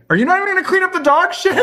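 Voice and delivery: very high, fast indignant squawk; mock indignant. Man to officer driving off, mock indignant. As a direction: protest in disbelief, played for laughs. Complaint to officer who leaves.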